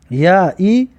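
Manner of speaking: ordinary delivery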